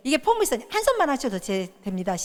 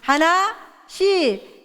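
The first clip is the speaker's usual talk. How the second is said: drawn-out, very slow and higher; 6 semitones higher, 4 dB louder, much slower than usual. Shown as a demonstration. A teacher drilling the class very slowly. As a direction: stretch each syllable. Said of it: "하나씩입니다".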